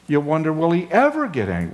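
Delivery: ordinary talk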